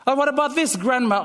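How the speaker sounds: put-on high, eager child voice asking grandpa; curious grandchild. Grandchild asking grandpa about old photos, high and eager. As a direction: play the curious kid, pitched well up.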